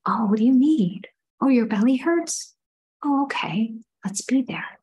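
soft tender whisper; soothing whisper. Retold or imitated. A mother whispering comfort to a toddler with a sore belly. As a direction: hush and comfort a little one.

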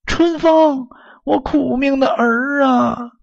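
high, drawn-out wail of a mother calling her son; mother's anguished cry. A narrator's reading. Mother spots her long-lost son from afar, crying out. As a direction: call out high and slow, lamenting. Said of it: "举手喊道".

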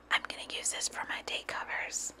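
soft breathy whisper of a private plan; hushed, secretive inner voice; whispered thought. Retold or imitated. Retelling her own thought.

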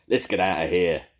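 loud urgent call; shouted. A narrator's reading. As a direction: push the crew to flee. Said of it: "shouted Jake".

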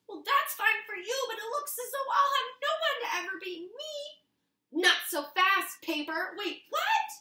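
high-pitched character voices. Acted. Rock and Paper chatter, high and playful. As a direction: play the paper knight and rock.

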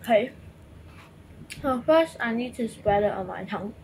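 talking around gum in mouth; speaking mid-chew; chewing gum while talking. Real, not acted. Seen 1: Jaw and cheek movement shows chewing; gum wrapper on table; gum on tongue later.

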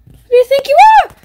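high, fast squeaky taunt; mock squeaky outrage. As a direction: squeak it out like an offended cartoon. Mock tough guy squeaky voice.

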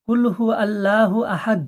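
fast, clipped কুল হু আল্লাহু আহাদ; rushed wrong reading. Shown as a demonstration. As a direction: hurry through it carelessly. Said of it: "এরকম পড়বেন না". (তাড়াহুড়ো করে ভুল পড়া)

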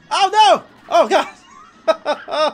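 high, loud burst of panic; alarmed yell. Real, not acted. Player almost yeeted off the plane, alarmed yell. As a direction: cry out in sudden alarm.

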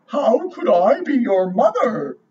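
cow voice. A narrator's reading. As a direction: give the cow a gentle, different voice. Cow speaks in story.

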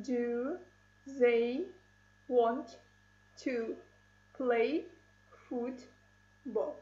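"Do they want to play football?" said one word at a time with pauses. Shown as a demonstration. Teacher shows pupils syllable count, pausing between words. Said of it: "How many syllables?".

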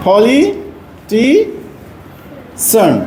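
Politician stretched out very slowly; slow careful example. Shown as a demonstration. Teacher slowly models politician as an example. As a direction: draw out every syllable of politician. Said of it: "যেমন".

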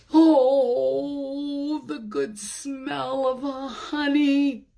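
ordinary delivery, nothing marked

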